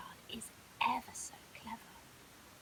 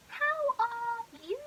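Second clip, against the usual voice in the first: posh crocodile; 10 semitones higher, 7 dB louder, slower than usual. Acted. Crickle concerned.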